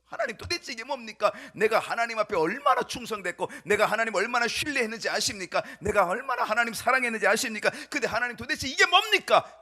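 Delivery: loud, indignant protest to God; resentful complaint. Acted. David blaming God after his son's revolt, resentful. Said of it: "가장 먼저 하나님께 원망하겠죠".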